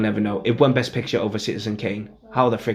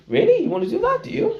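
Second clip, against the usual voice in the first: very high-pitched acted disbelief; mock surprise; faster than usual. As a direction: leap right up high, playing surprised. Acted surprised reply to an actor.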